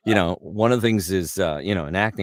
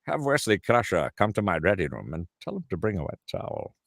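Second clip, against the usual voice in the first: theatrical British starship captain giving orders; Patrick Stewart; 3 semitones higher than usual. Retold or imitated. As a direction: issue a stately command, British stage diction. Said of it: "Patrick Stewart voice for him".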